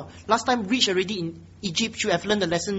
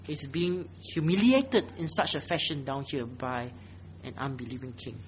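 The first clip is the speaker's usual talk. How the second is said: low, soft, sorrowful preaching; hushed, sober regret; subdued lament; 6 semitones lower, 10 dB quieter than usual. Real, not acted. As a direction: lament it quietly and gravely. Preacher laments Abraham's humiliation.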